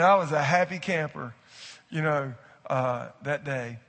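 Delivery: normal talking voice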